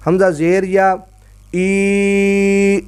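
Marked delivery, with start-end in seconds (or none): 1.5-2.8 ای held long and steady as eeee. Shown as a demonstration. Teacher holds the vowel so the pupil hears it. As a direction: stretch ای out evenly. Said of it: "تو لمبا کریں گے".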